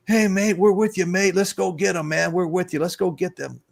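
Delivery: high, bright mock Aussie "mate" voice; cheerfully imitating Australians rallying behind him; Australian accent. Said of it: "They were like".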